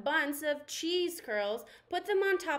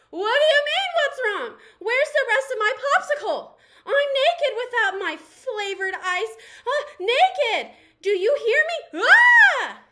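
much higher, much louder frantic ranting; panicked shriek; 10 semitones higher, 13 dB louder than usual. Acted. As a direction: freak out in total panic. Popsicle stick man panicking.

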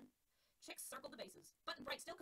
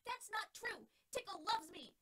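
put-on high, loud, hurt childish yell; yelled back; 9 semitones higher, 6 dB louder, much slower than usual. Pitcher yelling back at Alex, high, loud and hurt. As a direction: shout it back indignantly. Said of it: "the pitcher yelled back".

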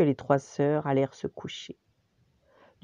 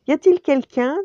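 timid call; 10 semitones higher, 9 dB louder than usual. Acted. Calling into empty castle.

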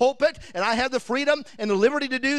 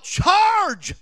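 loud, high charge cry; battle yell; 9 semitones higher, 5 dB louder than usual. Retold or imitated. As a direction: yell it as you leap the wall. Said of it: "he yelled".

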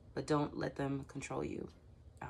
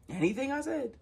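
exasperated disbelief; 11 semitones higher than usual. Real, not acted. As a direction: fling it back in disbelief, pitch shooting up. Exasperated repeat of own question.

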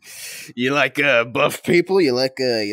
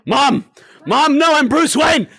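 panicked yell; 10 semitones higher, 4 dB louder than usual. Real, not acted. Panicked yelling at mom.

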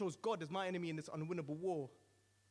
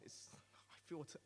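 unvoiced, slow, faltering breath; whispered defeat; 10 dB quieter, slower than usual. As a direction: let the voice die to a whisper. Defeated, voice gives out.

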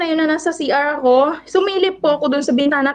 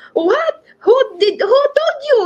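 alarmed question; 12 semitones higher than usual. Retold or imitated. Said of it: "Sabi ko".

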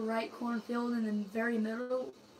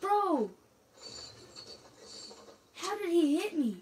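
exasperated yelling; 8 semitones higher than usual. Real, not acted. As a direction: yell it in disbelief. Getting shot, can't see shooter.

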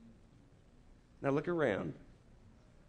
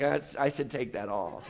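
sheepish laughing aside; 7 semitones lower, 6 dB quieter than usual. Retold or imitated. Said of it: "and you're like".

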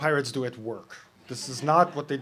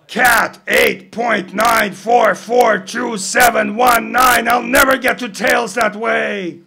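loud, higher, slow shouted numbers; slow shouted count; 10 semitones higher, 16 dB louder, slower than usual. Acted. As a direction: spell out each digit in a bellow. Captain shouting digits up mast.

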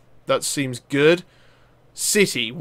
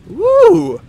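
loud, high-pitched woo; high whoop; 7 dB louder than usual. Real, not acted. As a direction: let out a long, high whoop. Whoops while playing the game.

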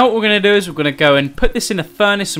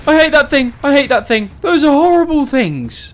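frantic; 9 semitones higher than usual. Real, not acted. Fleeing the creatures.

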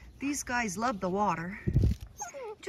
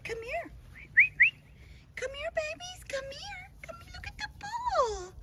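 put-on baby talk to puppies.

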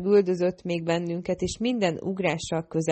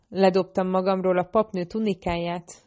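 plain reading voice

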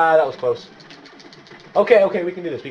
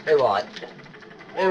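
normal talking voice

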